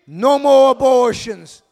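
slow, loud chant; call for response. Shown as a demonstration. Pastor leads the church in a slow, loud chant. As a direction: lead the crowd in the chant. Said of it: "Come on, say it with me".

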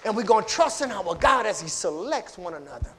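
loud, high, ringing preacher's cry; full-force preaching. Real, not acted. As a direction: rouse the congregation.

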